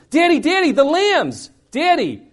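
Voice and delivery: put-on high, eager child's call; a thrilled daughter running out; excited little girl. Daughter running to dad about the lambs, excited high call. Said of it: "She's so excited".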